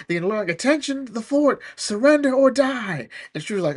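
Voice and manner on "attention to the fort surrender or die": hammed-up drill sergeant; mock military command. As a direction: bellow a demand at the fort. Soldiers demanding surrender.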